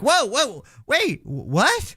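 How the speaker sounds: retold gasp of disbelief; mock surprise. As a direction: act out the jolt of hearing him speak. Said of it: "and I was like".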